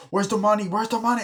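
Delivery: high-pitched panicky questioning; frantic demand. Retold or imitated. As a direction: act out someone desperately searching. Acting out a frantic search.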